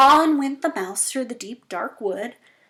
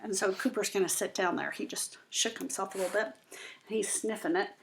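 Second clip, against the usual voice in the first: hushed fast whisper about the dog; soft whispered aside while the dog sniffs; 3 semitones lower, 9 dB quieter, faster than usual. Real, not acted. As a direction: drop to a quick whisper so as not to disturb. Aside about the dog mid-reading.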